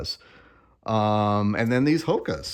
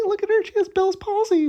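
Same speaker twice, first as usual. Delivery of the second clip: put-on much higher, squeaky teasing girlfriend voice; mock girlfriend voice; 6 dB louder than usual. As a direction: put on a high, giggly voice to mock her. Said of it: "My girlfriend pointed out".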